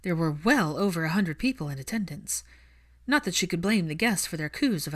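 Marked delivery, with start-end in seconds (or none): none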